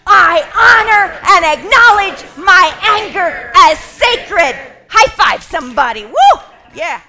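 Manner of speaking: very high, very loud chant leading; fiery rallying shout. Shown as a demonstration. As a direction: whip the crowd up with fire energy. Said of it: "Let's make some noise".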